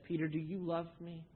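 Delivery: soft, gentle asking; tender question. Acted. Jesus to Peter on the shore, gently asking. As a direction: ask him softly, the first time.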